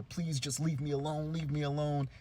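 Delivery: lightly acted, agitated begging; pleading professor. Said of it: "he's swatting at them and telling them".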